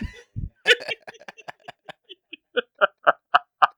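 long, loud, high-pitched cackle; real high wheezing laughing fit; helpless laughter. Real, not acted. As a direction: crack up completely and keep going. Cracks up at movie impression.

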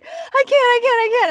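high, loud, repetitive panicked protest, acted; retold panic. Swimmer retelling her reply to friend, mock panic. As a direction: wail it high, mimicking your own panic. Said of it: "I started panicking".

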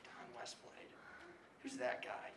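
whispered, puzzled, to himself. Retold or imitated. Speaker retelling his confused reaction to the author's name, whispered. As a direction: hush it like a private double take.

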